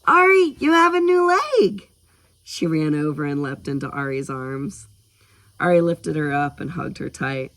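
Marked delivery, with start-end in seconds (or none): 0.0-1.9 put-on very high, loud little-girl squeal; shrill-pitched burst of excitement; excited squeal. A narrator's reading. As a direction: squeal it out with delight. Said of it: "Skylar squealed".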